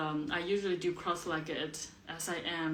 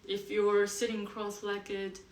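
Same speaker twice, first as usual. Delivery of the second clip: slow, spaced-out meditation guidance; guided meditation; 3 semitones higher than usual. Acted. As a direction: settle listeners gently into stillness, pausing between phrases.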